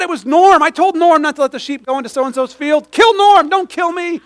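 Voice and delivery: high, loud frantic pleading; mock panic, pointing at a friend; panicked shepherd. Shepherd panicking, blaming Norm to God, frantic.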